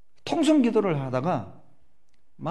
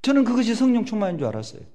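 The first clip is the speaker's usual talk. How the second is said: louder, higher emphatic refrain; 7 semitones higher, 5 dB louder, faster than usual. Real, not acted.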